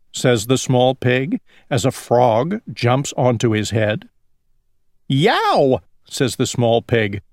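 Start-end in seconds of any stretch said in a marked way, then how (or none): 5.1-5.8 high, drawn-out mock yow; storybook yelp of pain. A narrator's reading. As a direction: cry out high as if nipped on the tail. Pig bitten by a turtle.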